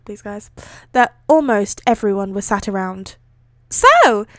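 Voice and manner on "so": put-on bubbly girl. Character line starts.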